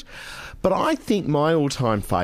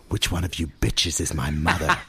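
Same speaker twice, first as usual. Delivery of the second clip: Phoebe Cates line; 6 semitones lower than usual. Acted. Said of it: "that's the fabulous line that Phoebe uses".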